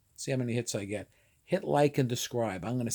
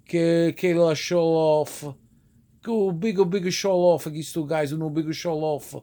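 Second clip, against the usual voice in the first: loud, drawn-out Italian-accented English; Italian-American street talk; Italglish; 3 semitones higher, 5 dB louder, slower than usual. Shown as a demonstration. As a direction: exclaim it in Italian-American dialect. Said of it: "That's what they'd say it".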